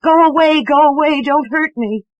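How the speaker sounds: high, loud terrified scream. A narrator's reading. Ghostly child screaming at Eleanor to go away, high loud shriek. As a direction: shriek it at the tormentor. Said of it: "it screamed".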